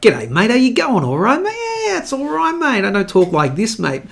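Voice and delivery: hammed-up Aussie mate greeting, swooping high; caricatured broad Australian, pitch leaping up; broad Australian accent. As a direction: put on an exaggerated ocker Australian twang. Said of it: "I have an Australian accent".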